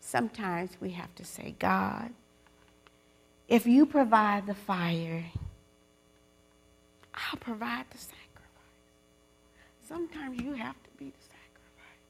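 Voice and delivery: near whisper, soft and intimate; hushed prayer. Real, not acted. Preacher whispering a prayer for the women to repeat. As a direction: breathe the words like a secret prayer.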